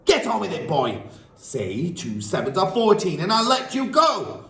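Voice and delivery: high, loud threatening command; bully him into obeying; furious demand. Acted. Headmistress threatening the dangling boy, high and loud.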